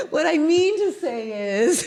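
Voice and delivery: high, slow, giggly mock earnestness; laughing through words. Resuming the speech after the quacks, high and laughing. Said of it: "and I'm like".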